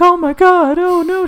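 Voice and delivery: very high hammed-up fright; mock panicked woman. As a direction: play-act a fleeing victim crying for help. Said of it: "she's, like, yelling for help".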